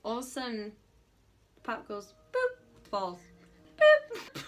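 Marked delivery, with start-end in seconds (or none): 2.3-4.5 high, loud cartoon boop; boop sound. Teller imitates the falling can on camera, loud high boop.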